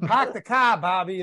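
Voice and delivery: put-on stock Boston accent, R's dropped; mock Boston accent. Host teasing guest about his accent, mock Boston accent. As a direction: caricature a Boston local. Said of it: "that kind of shit".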